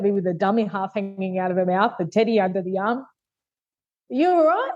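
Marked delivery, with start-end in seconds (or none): 3.0-4.7 put-on high, slow little-child voice; tiny worried child; toddler voice. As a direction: gently imitate a small daughter asking. Toddler at shower glass.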